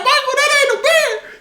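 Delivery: high pitched laughing correction; laughing high yell. Retold or imitated. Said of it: "I'm like".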